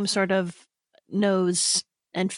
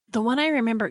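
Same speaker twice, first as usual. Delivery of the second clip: ordinary talk, nothing marked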